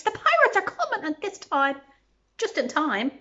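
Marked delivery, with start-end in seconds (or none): 0.0-1.0 high, quick alarm; alarmed cry. Acted. Villagers crying out that the pirates are coming. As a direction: blurt it out in fright. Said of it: "gasped the villagers".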